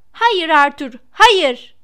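loud, high screamed protest; frantic wife yelling; crying out. A narrator's reading. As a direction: shriek at your husband to stop, rising on the repeat. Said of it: "diye haykırıyordu".

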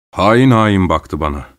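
usual speaking voice

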